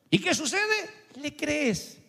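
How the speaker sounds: ordinary delivery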